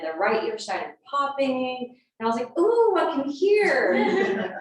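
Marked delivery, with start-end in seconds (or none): 2.6-4.6 a sudden high, slow squeal of delight; light up as the blocked ear finally pops; delighted surprise. Retold or imitated. Teacher reliving her ear popping open, delighted high surprise.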